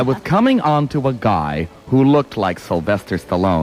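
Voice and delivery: theatrical verse, drawing out the last words; poem recitation. Acted. Partner takes a turn at the bawdy poem, poem recitation. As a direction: recite it, slowing into the final phrase.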